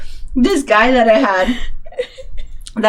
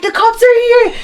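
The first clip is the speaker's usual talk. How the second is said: panicked shout; 13 semitones higher, 3 dB louder than usual. Retold or imitated. Drunk party, sirens in song.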